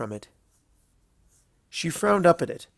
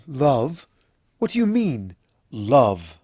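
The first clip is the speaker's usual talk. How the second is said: shocked; 4 dB louder, slower than usual. A narrator's reading. Luna stunned.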